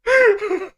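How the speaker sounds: squealing burst of laughter; a helpless laugh; high laugh. Real, not acted. Streamer cracking up again, squealing laugh. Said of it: "Man, I'm crying".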